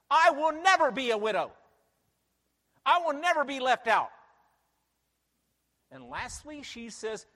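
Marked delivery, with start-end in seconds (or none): 0.1-4.1 loud, high defiant vow; emphatic shout. Acted. Preacher voicing the queen, shouting her defiant boast. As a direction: shout it, daring anyone to disagree. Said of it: "She becomes even more emphatic".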